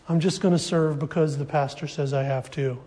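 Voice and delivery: low, soft mock reluctance; begrudging tone. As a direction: grumble it like an unwilling helper. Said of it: "with this begrudging spirit".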